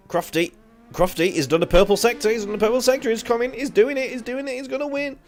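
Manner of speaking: excited race commentator impression; Crofty. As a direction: get hysterically carried away like a TV commentator. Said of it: "You're like the fucking Martin Brundle".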